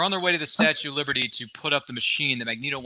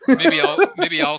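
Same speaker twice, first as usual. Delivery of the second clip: laughing; 7 dB louder than usual. Real, not acted. Laughs while speaking.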